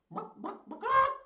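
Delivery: high squeaky clucking, hammed up; chicken clucking. The kids' chicken noise in a goofy cluck. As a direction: cluck like a chicken in a kid's game. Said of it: "Megan says".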